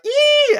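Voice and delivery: very high, loud mock squeal; excited squeal. Couple celebrating the raise, hammed-up excited squeal. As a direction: let out a piercing squeal of delight. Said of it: "so they're all excited".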